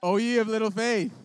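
mock preacher. Speaker teases the doubting crowd, in mock booming preacher style. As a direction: proclaim it like a grand old preacher. Volunteer stands on balloons.

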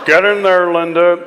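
high, loud American dad voice; enormous American father. Acted. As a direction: order her in like a big American dad. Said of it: "said the enormous father of the family".

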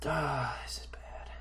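breathy whispered groan of defeat; despairing whisper. Real, not acted. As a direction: whisper it, crushed by the bad roll.

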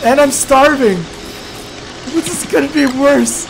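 loud, high despair; exasperated yell. Real, not acted. Gamer realizing he is starving too, loud yelling despair. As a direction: yell at the mounting trouble. Disaster piling up.

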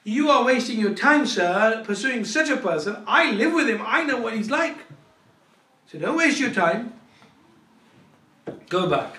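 loud, high-pitched scolding; acted indignant dressing-down; scornful tirade. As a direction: send the visitor packing, loudly. Wife berates visitor about husband.

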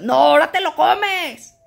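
a wife refusing to play mother, mocking the order; mock bossy mother. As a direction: scold like a strict mom. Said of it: "yo no soy la mamá".

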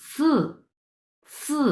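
সু hissed, starting high and coming down; fourth tone. Shown as a demonstration. Teacher demonstrates tone four on সু, high falling pronunciation. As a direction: pitch সু high, then let it fall. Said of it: "উপর থেকে নিচের দিকে আসবে উচ্চারণটা".

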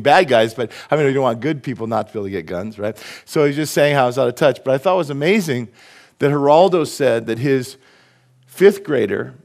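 ordinary talk

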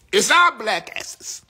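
loud, high and slow; angry outburst. Real, not acted. Blaming the men in her chat, a loud slow outburst. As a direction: spit each word out, furious.

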